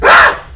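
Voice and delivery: loud wordless angry scream; raging scream. Acted. Mother losing it at the baby, loud scream. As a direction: let out a furious shout.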